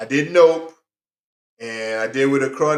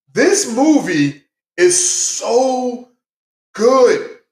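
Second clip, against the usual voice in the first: slow, high and a bit louder, stretching out so good; drawn-out excited praise; 8 semitones higher, 3 dB louder, much slower than usual. Real, not acted. Vlogger raving to viewers about the movie, slow and excited. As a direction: gush over how good it is.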